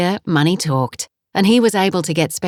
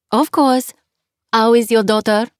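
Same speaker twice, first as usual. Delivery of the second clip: put-on 2 cheerful landlady with a heavy French accent; 3 greet her warmly in thick French-accented English; 4 French guest-house owner, heavy French accent; 5 semitones higher than usual. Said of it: "her accent was heavy".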